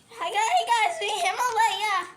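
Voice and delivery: lightly voiced character intro. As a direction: greet the fans as a different character. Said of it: "Himalaya don't sound like that".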